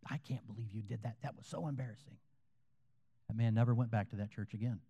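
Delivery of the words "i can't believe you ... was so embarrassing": imitating an embarrassed wife, much softer; quiet mock scolding. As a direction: scold under your breath, acted. Acts out wife scolding husband.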